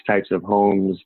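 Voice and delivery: ordinary delivery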